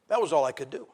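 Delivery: ordinary talk, nothing marked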